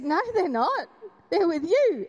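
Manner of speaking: high, rising then loud insistence; insistent denial. Retold or imitated. Insisting to her mum the kids are with her, high and emphatic. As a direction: push back in disbelief.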